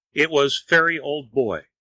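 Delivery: plain reading voice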